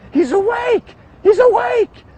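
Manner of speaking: high excited scream; screaming nurse. Retold or imitated. Nurse screaming to the room that he woke, high and loud. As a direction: shriek it out, overjoyed. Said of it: "I just hear screaming".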